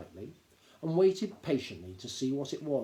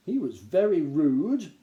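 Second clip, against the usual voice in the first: stout; 4 semitones higher, 8 dB louder, slower than usual. A narrator's reading. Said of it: "said Granny Morky stoutly".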